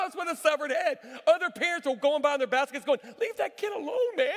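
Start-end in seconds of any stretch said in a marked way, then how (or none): 3.2-4.3 high-pitched mock indignant parent; scolding bystander. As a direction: call out a hammed-up protest. Other parents scolding him in passing.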